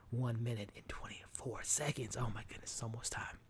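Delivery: whispered countdown, barely voiced; breathless excited whisper. Real, not acted. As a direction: hush it like a secret thrill. Excited countdown before the finale.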